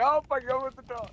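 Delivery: rushed, high-pitched imitation of panicking players; mock panic. Three cornered players at the door, imitated panic. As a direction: squeak it out fast, hammed-up panic. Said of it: "three motherfuckers all panicking like".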